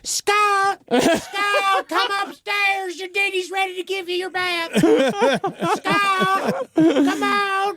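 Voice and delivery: high, shrill yelling dad upstairs. Pretend. Mocking a vampire dad calling his son Scott upstairs, high hollering yell. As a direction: holler for Scott like a hillbilly father.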